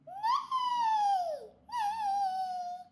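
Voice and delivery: very high neighing whinny; whinny like a horse. Acted. Child reading animal sounds.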